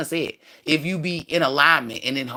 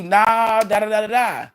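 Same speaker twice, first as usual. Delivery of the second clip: loud, high mocking dismissal; mock naysaying; 6 semitones higher, 4 dB louder than usual. Speaker mimics the physical self telling you no, mock naysaying. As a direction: mimic a naysayer, raised pitch and volume.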